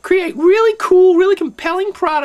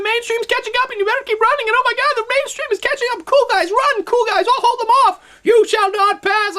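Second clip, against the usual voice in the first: higher, hammed-up hero holding them off; mock heroic panic; 7 semitones higher than usual. To the cool guys, a hammed-up heroic sacrifice. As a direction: ham it up like a last-stand hero.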